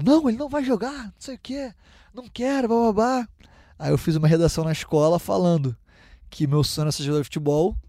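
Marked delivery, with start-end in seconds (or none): 0.0-3.3 a mock refusing dad, pitched higher; lightly voiced stubborn father, higher. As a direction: imitate the father's dismissive no, higher.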